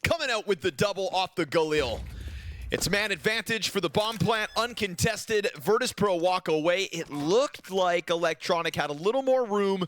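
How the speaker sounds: high-pitched burst of live commentary; excited play-by-play. Acted. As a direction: call a sudden turn in the match. Live esports play-by-play, key moment.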